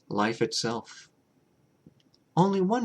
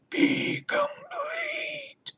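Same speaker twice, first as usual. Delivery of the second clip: slow, very high warped cry; eerie wailing cry; much slower than usual. A narrator's reading. Hive mind demanding completion, wailing. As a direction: let it rise into a wail. Alien voice climaxes.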